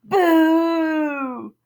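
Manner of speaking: high, loud ghostly boo; loud spooky boo; shouted boo. Acted. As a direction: jump out and yell it. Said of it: "and yelled".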